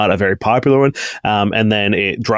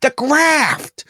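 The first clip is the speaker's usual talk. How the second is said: much higher, shrill-free call across the shop, stretched out; shouting to the back; slower than usual. Acted. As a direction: yell for Marcus in the back room, high and drawn out. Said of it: "And she just says".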